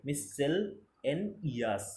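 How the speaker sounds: মিস সেল, এন ইয়াস broken into separate parts; মিস সেল, এন ইয়াস sounded out piece by piece; syllable-by-syllable pronunciation. Shown as a demonstration. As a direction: say মিস সেল, then pause before এন ইয়াস. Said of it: "উচ্চারণটা কী হবে".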